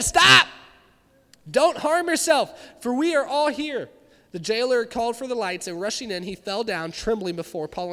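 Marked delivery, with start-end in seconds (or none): 0.0-3.9 loud urgent shout. Acted. Paul calling out to the jailer, loud shout. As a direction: yell to stop him harming himself. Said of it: "But he shouted".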